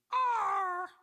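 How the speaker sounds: high, loud croaking cry; croaky parrot roar. Acted. Unseen parrot roaring at George as he steers in, loud croak. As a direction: let out a parrot's roaring squawk. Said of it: "a loud croaky voice roaring".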